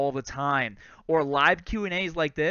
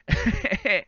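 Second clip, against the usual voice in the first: high burst of laughter. Real, not acted. Laughs at own gear hassle.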